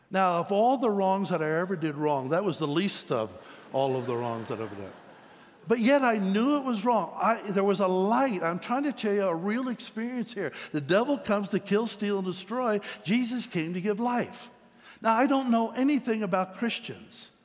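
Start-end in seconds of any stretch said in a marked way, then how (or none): 5.7-17.3 preaching at full force. Acted. Preacher urging the congregation about the devil and Jesus, at full force. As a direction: drive it home to the congregation.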